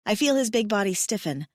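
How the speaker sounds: ordinary delivery, nothing marked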